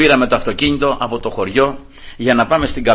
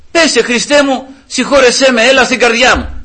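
raised, pleading high prayer; fervent prayer; 9 semitones higher, 4 dB louder than usual. Shown as a demonstration. Son teaching his father a fervent prayer to Christ. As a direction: pray it out, begging. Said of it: "Τι να πεις".

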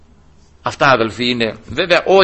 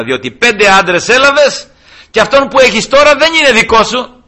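higher and louder, exposing her; stern revelation; 9 semitones higher, 7 dB louder than usual. Acted. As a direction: lay it bare with a raised voice. Jesus reveals her five husbands.

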